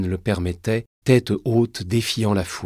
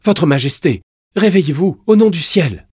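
high, loud pleading shout; louder, urgent rousing; 10 semitones higher, 11 dB louder than usual. Acted. Mother trying to wake the young king, urgent shout. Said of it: "puis plus fort".

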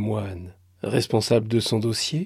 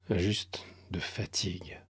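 chuchoté, épuisé; 2 semitones lower, 7 dB quieter than usual. A narrator's reading. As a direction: souffler la fatigue. Narration sombre. (narration chuchotée et lasse)

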